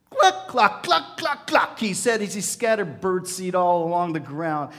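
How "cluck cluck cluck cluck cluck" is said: loud, high cluck cluck calling the chickens. A narrator's reading. Farmer calling the eagle back with birdseed, high clucking.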